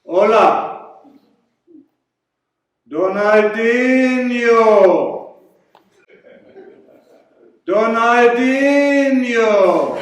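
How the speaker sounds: loud, high, drawn-out Mexican sing-song calling; high hammed-up Mexican accent, stretched and shouted; mock Mexican accent. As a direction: belt out Donaldinho like a Mexican street caller. Mexican in sombrero calls out.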